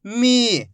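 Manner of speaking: मी with a long, held ee; teacher drawing out मी; drawn-out syllable. Shown as a demonstration. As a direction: stretch the vowel in मी for learners. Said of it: "खींच खींच के पढ़ना है".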